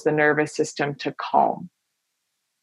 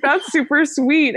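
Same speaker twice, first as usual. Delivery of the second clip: touched; 9 semitones higher than usual. Retold or imitated. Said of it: "I was like".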